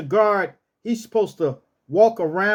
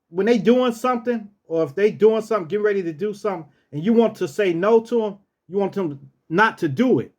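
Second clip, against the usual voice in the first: ordinary talk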